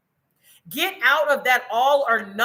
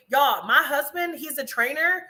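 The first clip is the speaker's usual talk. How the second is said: normal talking voice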